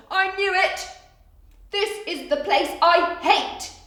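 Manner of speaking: a cat who hates the vet; high alarmed Mog; upset cat voice. Acted. Mog's panicked thoughts voiced.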